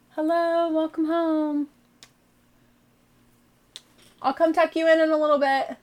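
high sing-song call to family. Real, not acted. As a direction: call through the window. Calls to family through window.

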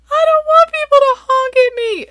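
put-on very high, loud child's wail; upset child yell. Daughter protesting the honk sign to mom, high upset yell. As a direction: imitate a kid's shrieked protest.

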